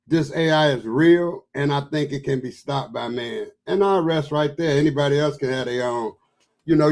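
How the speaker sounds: normal talking voice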